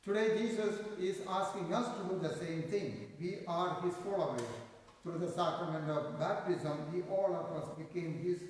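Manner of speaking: normal talking voice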